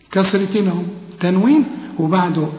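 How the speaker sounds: ordinary talk, nothing marked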